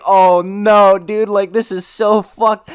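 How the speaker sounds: high, loud, dragging out so fucked; a friend watching the crash and panicking; mock panic. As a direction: freak out watching it go wrong. Panicked quote as screens crash.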